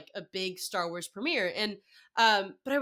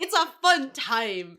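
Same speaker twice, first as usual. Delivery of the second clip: slow, higher, louder mock cheer; wry understatement; 4 semitones higher, 5 dB louder, slower than usual. Real, not acted. Telling the host how Twitter is, wryly drawn out. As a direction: draw it out with ironic brightness.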